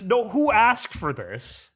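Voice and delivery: high, slow exasperated plea. Real, not acted. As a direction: throw your hands up and ask. Exasperated rant about remakes.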